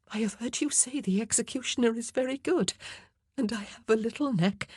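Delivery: a frightened queen half-whispering her wish to be done. A narrator's reading. Anne voiced, nervous jest.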